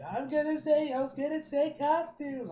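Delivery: high, excited character voice; silly put-on voice. Excited friend proposing costumes, silly high voice. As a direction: push it up high and blurt it out. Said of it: "why I'm talking like this".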